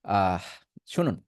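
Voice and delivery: ordinary delivery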